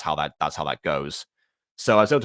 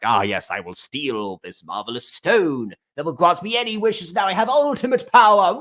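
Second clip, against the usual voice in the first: hammy cartoon villain, rising higher; gloating cartoon baddie, climbing to a loud finish; mustache twirling villain; 10 semitones higher, 5 dB louder than usual. Shown as a demonstration. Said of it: "cartoonish mustache twirling thing".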